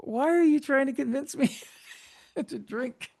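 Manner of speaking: high, giggly retelling of grandson; amused mock bewilderment; laughing quote. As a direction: laugh through the question. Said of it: "he's like".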